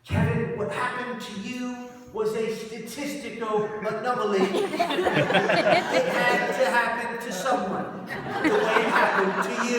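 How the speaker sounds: put-on loud, high-pitched British accent; hammed-up British friend, slow and emphatic. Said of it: "That's my British accent".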